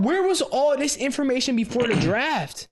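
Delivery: high-pitched indignant demand; exasperated outburst. Retold or imitated. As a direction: replay your outraged disbelief, pitch jumping up. Acting out his outraged reaction.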